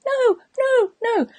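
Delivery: very high, drawn-out mock wail; inner protest. You inwardly refusing after saying yes, mock wail. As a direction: wail no in comic despair. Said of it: "But inside you're going".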